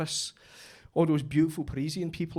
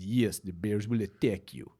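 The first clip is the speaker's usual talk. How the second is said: put-on Russian accent; 8 semitones lower than usual. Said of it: "He said".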